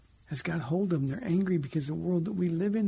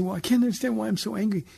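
higher, quick, puzzled mock complaint; whiny bewildered complaint; 6 semitones higher, 4 dB louder, faster than usual. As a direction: rattle it off like a baffled complainer. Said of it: "And they say".

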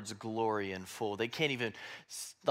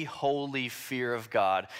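usual speaking voice

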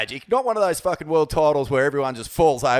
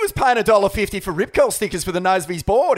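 excited; 6 semitones higher, 4 dB louder, faster than usual. Acted. Fast hyped commentary.

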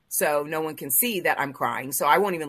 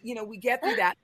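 ordinary talk, nothing marked

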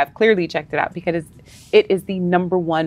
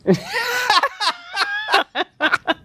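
high cackling laugh; shrieking laugh; 3 dB quieter than usual. Real, not acted. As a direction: burst into a shrill laugh. Big laugh.

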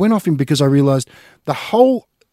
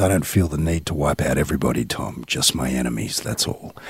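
low, hushed mafia boss; quiet menacing Michael Corleone voice; Godfather impression; 12 semitones lower, 5 dB quieter than usual. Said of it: "a speech that [name] makes".

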